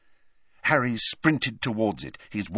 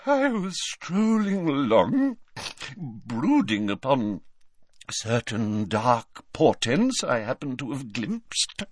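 slow, airy, portentous professor; dramatic mystic with long pauses; theatrical seer; 4 semitones higher, slower than usual. A narrator's reading. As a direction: intone it grandly, drawing out the words. Voices Trelawney in story.

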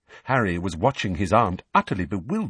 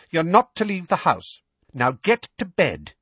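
firm, raised-pitch command; brisk, snappish; 8 semitones higher, 4 dB louder than usual. Acted. Aunt dismissing her nephew, brisk and snappish. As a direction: order the child off to bed. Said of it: "regaining her usual brisk, snappish manner".

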